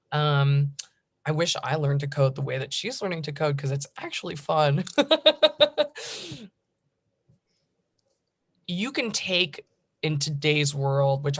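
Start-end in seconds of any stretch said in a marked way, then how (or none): 4.9-6.4 high-pitched burst of laughter; laughing. Real, not acted. Panelist amused that learning to code is actually fun, high laugh. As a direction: laugh out loud, high and rippling. Laughs after own remark.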